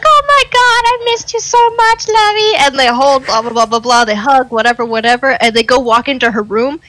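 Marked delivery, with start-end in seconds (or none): 0.0-2.7 exaggerated lovey-dovey squeal; gushing girlfriend imitation. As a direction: squeal it like a lovesick greeting. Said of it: "she'll walk up and be like".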